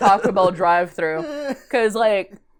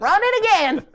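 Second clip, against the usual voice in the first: high-pitched imitation of an irate customer; hammed-up, high mock demand; mock angry demand; 11 semitones higher than usual. Southern customer arguing with drive-thru worker, high mock outrage. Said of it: "we were arguing for, like, ten minutes".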